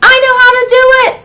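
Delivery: put-on very high, fast, loud child voice; eager holler. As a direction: burst out with excitement. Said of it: "Zoe hollered".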